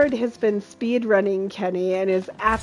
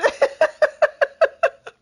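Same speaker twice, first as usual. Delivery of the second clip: high, quick real giggles; giggling. Real, not acted. Streamer jokes about the Glaives, giggling. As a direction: laugh helplessly at your own joke.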